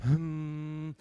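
long, loud mock-meditation drone; meditative hum. Back to meditating on the mountaintop, mock meditative hum. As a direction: hold a low, steady hum, playing at meditation.

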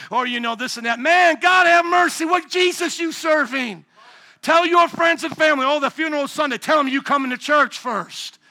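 loud, high fiery preaching; preaching at full force. Acted. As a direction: drive it home to the congregation.

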